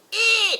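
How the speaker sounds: high, loud elephant squeal imitation; imitated elephant scream. Guide mimicking the cross elephant's answer, loud squeal. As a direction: squeal back like an angry elephant. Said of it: "And she would say".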